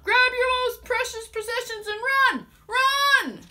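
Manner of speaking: loud, high panicked shout; men yelling. Retold or imitated. Men warning the town to flee, loud shout. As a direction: urge everyone to flee now. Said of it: "Men yelled".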